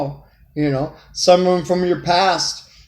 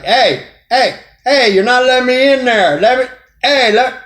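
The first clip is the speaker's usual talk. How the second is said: high-pitched, playful complaining; mock protest; 8 semitones higher, 3 dB louder than usual. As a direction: act out someone insisting on being let in. Acts out partner not being let in.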